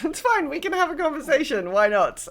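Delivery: high, strained mock-cheerful giving in; acted reluctant reassurance; exasperated agreement. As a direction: concede with put-upon brightness, pitch jumping up.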